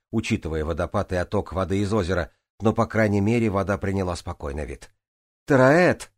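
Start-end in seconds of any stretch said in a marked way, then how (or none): 5.5-6.1 loud, higher-pitched call; shout. A narrator's reading. Джанель calls to Тараэт who hasn't surfaced, shouting. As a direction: call out for someone missing. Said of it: "крикнула Джанель".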